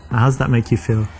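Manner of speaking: normal talking voice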